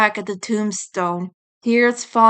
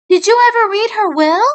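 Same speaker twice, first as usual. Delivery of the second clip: put-on high young female rabbit voice, louder and direct; pointed question as the sister; 12 semitones higher, 6 dB louder, faster than usual. Sister presses Nick about Judy's will, high louder voice. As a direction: push him with the question. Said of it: "the rabbit asked".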